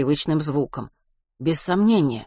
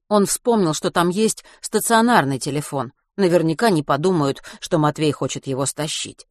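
ordinary delivery, nothing marked

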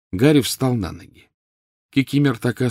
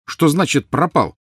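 higher, incredulous question; alarmed demand; 7 semitones higher, 5 dB louder than usual. A narrator's reading. Boy losing hope, alarmed demand. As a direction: demand to know what that means.

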